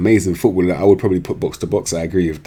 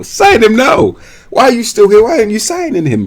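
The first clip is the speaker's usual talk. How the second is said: high, loud demand; urgent shout; 12 semitones higher, 4 dB louder than usual. Real, not acted. YouTuber yelling at viewers to sign the player, high and loud. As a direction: yell it at the viewers.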